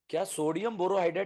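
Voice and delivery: normal talking voice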